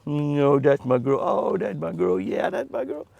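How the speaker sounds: soft, low cooing to a pet. Real, not acted. Rancher cooing to his pet deer, soft and low.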